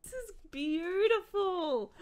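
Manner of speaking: high, slow, drawn-out admiration; gushing delight. Real, not acted. Creator admiring the new headset, gushing high. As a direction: stretch it out in awe.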